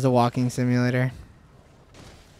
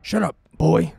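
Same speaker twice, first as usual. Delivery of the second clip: lightly voiced God of War dad; mock scolding of a son; mock stern father. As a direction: play a hint of a stern game father.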